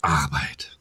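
a playful whisper to end the title. Pretend. Co-host whispers the podcast name to finish it.

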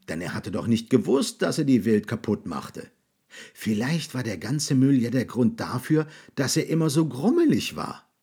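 ordinary delivery, nothing marked

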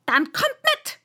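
very high, loud command; bellowing queen. A narrator's reading. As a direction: yell the order at full royal force. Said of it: "brüllte die Königin".

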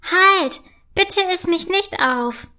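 high, cheeky call of a tiny gummy bear; freche Stimme. Acted. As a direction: shout it cheekily, small and high. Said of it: "ruft eine freche Stimme". (frech und hoch gerufen)

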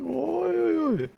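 high, drawn-out ui ui ui of dismay; a squeaky oh-dear at bad news; worried ui ui. Real, not acted. Friend hearing the game needs always-online, high dismayed ui ui ui.

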